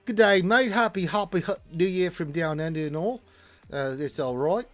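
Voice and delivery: hearty put-on Aussie voice; Australian accent. Host reads a viewer's New Year greeting, Australian accent. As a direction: read it like a cheery Australian mate. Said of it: "I did an Aussie voice".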